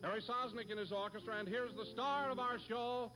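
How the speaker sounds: building to a big star introduction; excited announcer. Acted. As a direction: hype up the cast, big finish.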